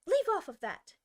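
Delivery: very high, loud scream. Acted. Queen at the bowing gardeners, screaming. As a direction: scream it in fury. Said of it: "screamed the queen".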